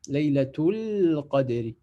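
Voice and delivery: slow "Laylatul Qadri" with the L held long into the Q; linked, alif dropped. Shown as a demonstration. Teacher repeating the liaison for students, "Laylatul Qadri" slowly linked. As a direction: glide from "Laylatul" into "Qadri" without any alif. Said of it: "Je vais passer directement".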